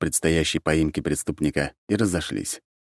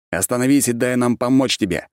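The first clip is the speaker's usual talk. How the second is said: raised, commanding plea; urgent appeal; 7 semitones higher, 4 dB louder than usual. A narrator's reading. Archmage urges the mad ex-emperor to stop, raised voice. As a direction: call out firmly to get through to him.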